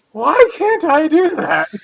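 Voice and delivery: high, wistful awe; reverent envy. Acted. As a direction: sigh it out in awe. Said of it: "the most reverent tones".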